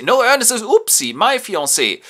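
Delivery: high, loud mock-girlish voice; hammed-up high girlish protest; mock young lady. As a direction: squeal it like a flustered young lady. Girl's voice in retold play.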